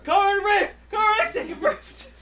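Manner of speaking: loud, high tokusatsu callout; hero call shout. Pretend. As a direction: shout it like a henshin.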